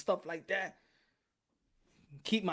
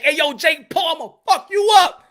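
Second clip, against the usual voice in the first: mock callout; 11 semitones higher, 14 dB louder than usual. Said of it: "when I be like".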